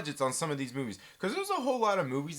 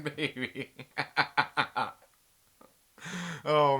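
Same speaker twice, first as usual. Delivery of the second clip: ordinary talk, nothing marked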